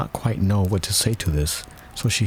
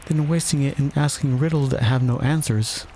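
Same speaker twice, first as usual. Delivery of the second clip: put-on higher-pitched young heroine; Alice's storybook voice; 7 semitones higher than usual. A narrator's reading. Alice scolding the Hatter over riddles, in character. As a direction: voice Alice a little above the narration.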